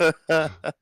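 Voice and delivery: high, loud laugh; real high-pitched laugh. Real, not acted. Guest laughs after introducing himself.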